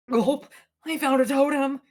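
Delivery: high, slow, nervous gulp; nervous Dwight voice. Retold or imitated. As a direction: squeak it out like a scared nerd. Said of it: "you're playing Dwight, and he's like".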